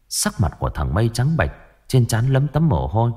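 usual speaking voice

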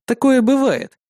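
ordinary delivery, nothing marked

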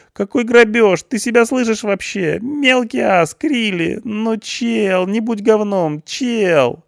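very high, nasal-free whining youth; a scared punk pleading his case; whiny punk. A narrator's reading. Punk pleads with the policeman, high frantic whine.

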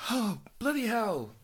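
sighing through heartburn pain; wincing from heartburn. Real, not acted. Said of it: "horrible time to have heartburn".

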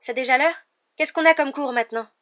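high, loud, flustered questions; panicked. A narrator's reading. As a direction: blurt them out, just woken and alarmed. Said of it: "l'air affolé".

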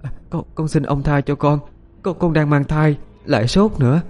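high, halting frightened woman's plea; acted timid, high-pitched pleading; tearful pleading. A narrator's reading. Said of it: "khóc lóc van xin".

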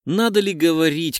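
ordinary delivery, nothing marked

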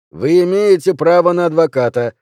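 loud, high official recital; policeman reading rights. A narrator's reading. Policeman repeating rights over a protest, loud and high. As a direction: repeat the right firmly and loudly.

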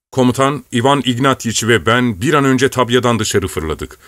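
plain reading voice

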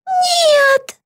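very high cry of "no"; horrified wail. A narrator's reading. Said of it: "завопила Битти". (отчаянный вопль высоким голосом)

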